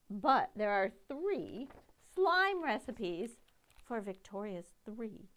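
usual speaking voice

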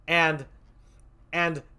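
ordinary talk, nothing marked

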